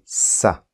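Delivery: "Sa" with a hissed voiceless S and no voice in it. Shown as a demonstration. Teacher showing students the voiceless S, hissed "Sa". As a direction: breathe out "Sa" as a pure hiss. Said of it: "le S sourd".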